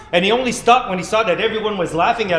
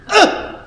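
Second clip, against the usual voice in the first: high loud cry of pain; pained ugh; 7 dB louder than usual. Acted. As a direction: yelp like a splinter got you. Splinter pain.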